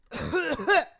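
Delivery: loud cough from the base of the throat; guttural fake cough. Shown as a demonstration. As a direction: hack hard, almost retching. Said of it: "get that guttural sound right here".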